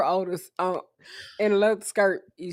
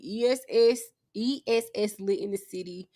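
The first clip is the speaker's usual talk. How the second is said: usual speaking voice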